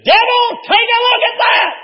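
high, loud triumphant cry; dying father shouting down the devil; defiant shout at the devil. Retold or imitated. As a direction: throw it at the devil, victorious. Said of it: "and he said".

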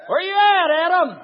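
loud, high call to someone hiding; booming preacher playing God; God calling Adam. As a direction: call out across the garden.

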